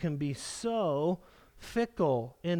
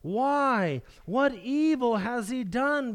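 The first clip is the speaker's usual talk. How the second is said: louder, higher, slowed protest; indignant Pilate; 5 semitones higher, 4 dB louder, slower than usual. Retold or imitated. Pilate protesting loudly to the shouting crowd. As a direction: demand an answer from the mob. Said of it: "But Pilate said to them".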